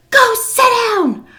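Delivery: very high, strained mock scream; enraged old-lady outburst. Miss C waving a knife at her helper, mock-furious screech. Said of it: "She was so mad".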